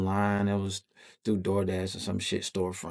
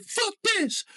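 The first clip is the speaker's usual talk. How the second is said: very high-pitched furious cry; acted-out rage; angry outburst. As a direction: explode in a high screech.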